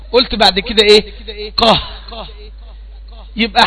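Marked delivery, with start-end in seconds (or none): 1.5-1.8 "قه" as a short released qaf with an a vowel. Shown as a demonstration. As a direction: pop the qaf open on "قه". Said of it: "وبعدين قمت قايل المتحرك". ("قه" قاف متحركة قصيرة بالفتح)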